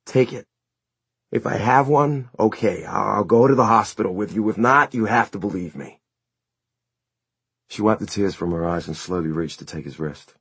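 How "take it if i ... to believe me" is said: raised, urgent plea; earnest pleading. Acted. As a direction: beg her to believe. Son pleading with mother.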